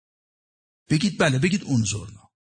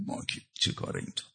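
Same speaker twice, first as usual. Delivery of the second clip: 1 humble whisper; 11 dB quieter than usual. Acted. Humble self-belittling aside.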